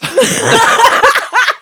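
a shrieking, very high laugh; loud squealing laugh. Real, not acted. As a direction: cackle hard at the joke. Laughs at nervous farts guess.

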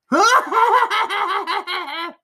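high, loud shrieking monster laugh; wild shrieking laugh. A narrator's reading. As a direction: cackle like a fiend gone wild. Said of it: "a wild and terrible shrieking kind of".

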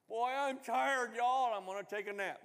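sleepy exaggerated mock-God voice; mock tired God. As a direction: play God yawning and worn out. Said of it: "No, that's not God".

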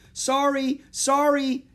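high, loud, hurried-crowd apology; yelled apology. A narrator's reading. Boy on rampaging elephant to shoppers, yelled apology. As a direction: yell apologies out to passers-by. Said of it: "yelled Jack".

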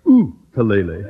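"ukulele" said as oo-koo-LAY-lee, stressing LAY; correcting pronunciation. Acted. Principal corrects the man on "ukulele", said as oo-koo-LAY-lee. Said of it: "put down that ukulele".

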